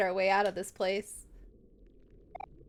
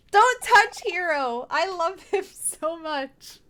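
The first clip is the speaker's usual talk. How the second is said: louder, higher, slow plea; protective cry; 6 semitones higher, 4 dB louder, slower than usual. Acted. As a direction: shield your brother. Girl protects her brother.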